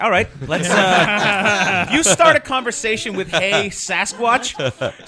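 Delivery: high, loud, laughing through the words; laughing disbelief. Retold or imitated. Comedian retelling a fan's odd greeting, laughing. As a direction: crack up while repeating his opener. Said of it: "I'm like".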